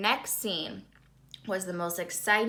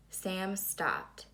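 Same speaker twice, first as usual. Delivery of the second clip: ordinary delivery, nothing marked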